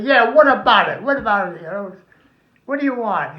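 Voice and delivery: mild mock yell what about it; defensive shout. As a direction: bark back defensively. Defensive reply to seller.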